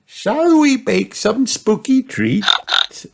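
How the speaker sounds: high, slow granny voice; a kindly grandma, slowly. A narrator's reading. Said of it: "said Granny Pig".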